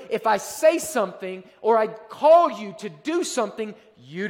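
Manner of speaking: preacher thundering at the congregation; full-force preaching. Acted. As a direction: preach it like a warning from the pulpit.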